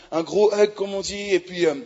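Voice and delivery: ordinary talk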